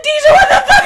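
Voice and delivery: high, loud freaked-out shout with a laugh; screechy laughing yell; retold friend yelling. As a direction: friend yelling at her after the prank. Friend yelling after the prank.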